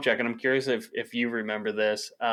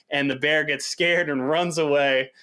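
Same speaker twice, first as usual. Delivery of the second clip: ordinary talk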